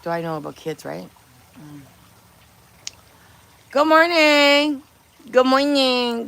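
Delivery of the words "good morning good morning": drawn-out greeting. Acted. Singsong stream greeting.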